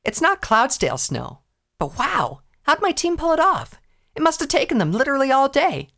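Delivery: put-on eager, boastful young pony; Rainbow Dash voice. Dash voice again.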